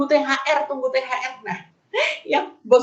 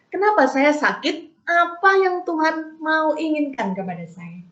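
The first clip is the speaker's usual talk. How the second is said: measured, sincere asking; earnest searching question; 4 semitones higher than usual. Acted. Sick believer seeking what God wants, earnest searching. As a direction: ask as one seeking an answer, without shouting. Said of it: "Tetapi saya mencari".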